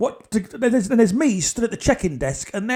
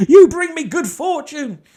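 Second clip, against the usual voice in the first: loud and slow; 8 semitones higher, 10 dB louder than usual. Retold or imitated. Explaining the proposal, loud.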